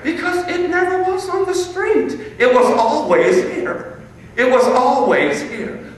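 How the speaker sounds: excited exclaiming. Real, not acted. Revelation to audience.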